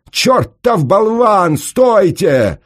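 very high, drawn-out, loud wail; howling. A narrator's reading. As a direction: howl it in alarm. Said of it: "взвыл пристав". (истошный вой)

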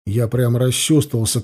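usual speaking voice

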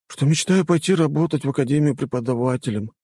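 high-pitched, mildly glum student; sad sigh. A narrator's reading. Student confessing his dream to the rector, sad. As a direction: admit it with understated sadness. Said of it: "грустно вздохнул тот".

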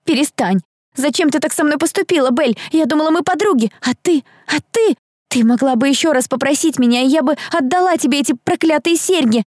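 put-on high-pitched, hurt girlish voice; distressed outburst. A narrator's reading. Girl reproaches her friend before fleeing, high and upset. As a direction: reproach a betraying friend, on the verge of tears.

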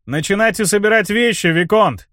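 high, merry call after him; cheerful shout. A narrator's reading. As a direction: call out gleefully to a rider leaving. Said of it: "весело крикнул я ему вдогонку".

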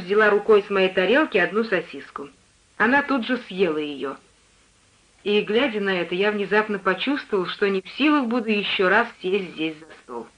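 plain reading voice

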